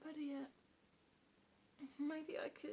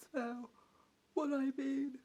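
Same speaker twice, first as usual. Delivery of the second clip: words stretched through a yawn, louder; 8 dB louder, slower than usual. Acted. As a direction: yawn it out right in their face. Yawning into listener's face.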